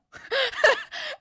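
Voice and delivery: helpless high-pitched laugh; burst of high laughter. Real, not acted. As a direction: crack up laughing. Breaks up at the story.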